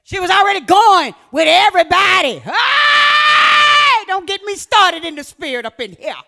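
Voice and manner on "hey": high, loud, drawn-out hey; sudden cry. Real, not acted. Preacher crying out mid-sermon to the church. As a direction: shout it out as the spirit hits.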